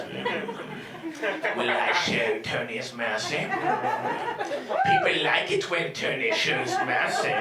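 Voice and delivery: slow, mock-regal musing; smug emperor Tony. Tony as Caesar toys with the crowd, slow and smug. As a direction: savour the power, slow and smug. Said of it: "Tony goes".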